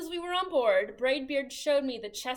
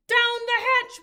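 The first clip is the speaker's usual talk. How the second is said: loud, high chorus; crew shout; 11 semitones higher, 11 dB louder than usual. A narrator's reading. Crew shouts back the dinner toast. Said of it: "we all shouted".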